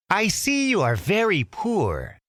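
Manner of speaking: high, sly magician voice. Acted. Magician luring Aladdin, high sly voice. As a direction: sneer gently, scheming. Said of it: "the magician said".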